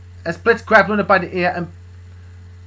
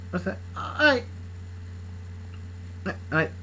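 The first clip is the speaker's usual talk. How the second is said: ordinary delivery, nothing marked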